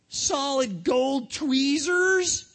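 slow, drawn-out disbelief; astonished shout. Acted. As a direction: stretch it out in shock. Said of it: "shouted Phil".

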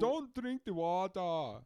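put-on slow, high, loud African accent; drawn-out mock African accent. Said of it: "your best African accent".

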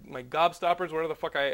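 ordinary talk, nothing marked

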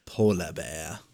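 low, soft figurine voice, r's dropped; hushed mock puppet voice; polar bear catchphrase. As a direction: echo the catchphrase quietly and low. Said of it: "holds up and just goes".

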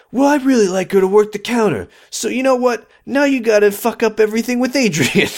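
high sarcastic voice; mock Leo. Parodying Leo bossing Ricky, high sarcastic.

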